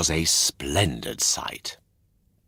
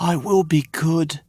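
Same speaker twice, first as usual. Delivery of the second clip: put-on higher, earnest little boy promising; eager puppet boy; 10 semitones higher than usual. A narrator's reading. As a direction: promise it with your whole heart. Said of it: "promised Pinocchio".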